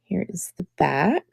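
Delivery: quiet whispered aside. Real, not acted. Turning the brooch over for viewers, whispered. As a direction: whisper it while you turn it.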